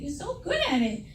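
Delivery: normal talking voice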